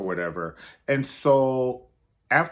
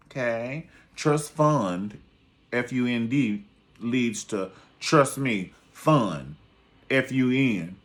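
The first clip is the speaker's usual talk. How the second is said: mock classroom teacher, spelling it out; mock teacher drill; 3 dB louder, slower than usual. Vlogger drilling viewers like a class, mock teacher voice. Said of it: "Say it with me, class".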